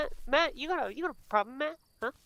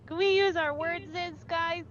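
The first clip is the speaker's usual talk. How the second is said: higher pleading peacemaker dog; pacifist dog voice again; 5 semitones higher than usual. Retold or imitated. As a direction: beg the others to calm down, pitched up. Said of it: "Noodles is more of the pacifist".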